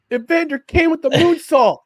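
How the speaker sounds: high, booming ringside play-by-play call; wrestling announcer voice. Pretend. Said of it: "in the wrestling announcer voice".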